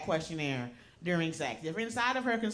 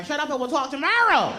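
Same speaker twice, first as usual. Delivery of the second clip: mock shout; 5 semitones higher, 6 dB louder than usual. Shutting down the partner.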